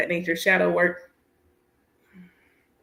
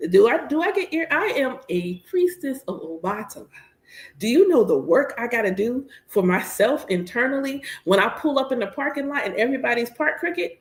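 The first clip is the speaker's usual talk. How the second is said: ordinary talk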